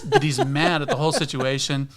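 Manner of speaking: ordinary talk, nothing marked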